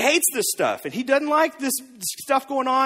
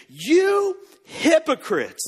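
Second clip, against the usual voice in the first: slow, drawn-out scornful rebuke; cutting rebuke; 6 semitones higher, much slower than usual. Retold or imitated. Jesus rebuking the religious leaders, lightly voiced slow scorn. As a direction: drag out the insult, each word landing. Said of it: "the biggest diss Jesus could give".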